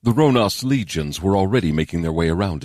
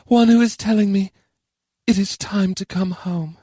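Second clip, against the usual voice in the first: high, wistful character voice; high-pitched, understated longing; 14 semitones higher than usual. A narrator's reading. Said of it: "Charon replied wistfully".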